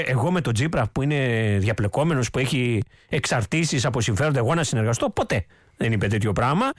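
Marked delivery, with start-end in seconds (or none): 0.1-5.1 lower, indignant imitation; mock quote of the leader. Host imitates what the leader should have said, lower and indignant. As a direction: lower the voice and play his outrage.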